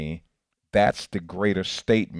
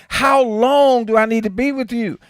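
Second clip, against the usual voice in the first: Jesus exasperated; 11 dB louder than usual. Retold or imitated. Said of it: "Jesus had to say to the disciple".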